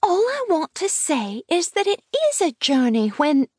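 put-on high child voice pressing the point; insistent little girl. A narrator's reading. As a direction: insist as a stubborn small girl.

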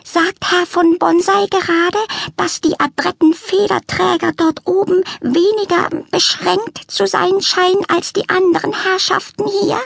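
high, prim, put-upon lament; complaining cat lady. A narrator's reading. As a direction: sniff at the disappointing zoo.

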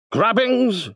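high shriek of a word; shrieking wizard. A narrator's reading. Wizard mishearing, shrieked. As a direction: cry it out like a mad wizard. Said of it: "shrieked the wizard".

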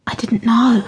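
stunned whisper. A narrator's reading. Jessie to Granny, shaken by the news, whispering. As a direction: keep it a breathless whisper. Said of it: "she whispered".

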